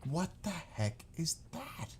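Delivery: high, soft, slow mock bafflement; baffled reaction. Retelling first hearing of subs and hoagies, baffled reaction. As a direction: draw out each word in disbelief. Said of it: "I'm like".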